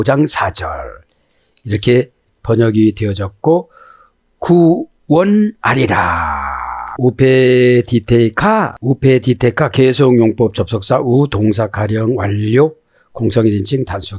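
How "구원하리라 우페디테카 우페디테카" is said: 우페디테카 drawn out syllable by syllable; slow Hebrew reading. Shown as a demonstration. Teacher sounding out the Hebrew word slowly. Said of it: "reading Hebrew slowly".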